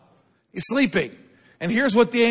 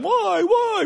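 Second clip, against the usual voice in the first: put-on much higher toddler voice asking why; child asking why; 14 semitones higher than usual. Preacher imitating a small child questioning a parent, high childish voice. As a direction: squeak it like a little kid pestering. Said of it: "your kids went".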